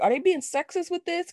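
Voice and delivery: high, disbelieving question; incredulous question. Retold or imitated. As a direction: ask it in shocked disbelief. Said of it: "I was like".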